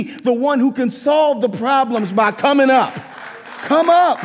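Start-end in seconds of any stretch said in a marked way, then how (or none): 3.7-4.2 a loud, high call upward; urgent call. Real, not acted. Preacher urging his church upward, loud rallying call. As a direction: lift the whole church with it. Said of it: "preacher urging church upward".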